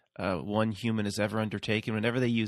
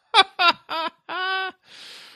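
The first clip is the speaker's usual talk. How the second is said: loud laughter; 8 dB louder than usual. Real, not acted. Bursts out laughing.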